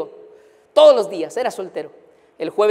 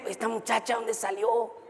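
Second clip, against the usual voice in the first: wondering aside; 3 semitones higher, 9 dB quieter than usual. Retold or imitated. Pastor's private thought about girl.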